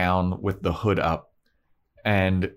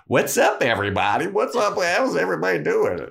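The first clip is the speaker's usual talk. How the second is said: chirpy Cordelia; 10 semitones higher, 3 dB louder than usual. Retold or imitated. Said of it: "And she's like".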